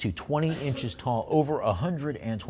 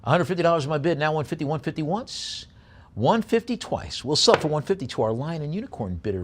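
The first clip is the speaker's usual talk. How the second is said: brisk auction chant, pushing for bids; auctioneer bid call; 6 semitones higher, 4 dB louder than usual. Real, not acted.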